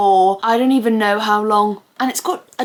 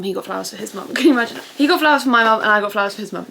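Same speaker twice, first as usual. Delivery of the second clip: normal talking voice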